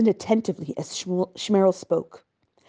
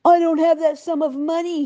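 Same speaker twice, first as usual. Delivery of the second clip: much higher mocking imitation of the lender; mocking Segel; 9 semitones higher, 4 dB louder than usual. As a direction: mimic his refusal with scorn. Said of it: "he tells me".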